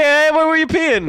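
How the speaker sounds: calling out. Real, not acted. Calls out louder repeat.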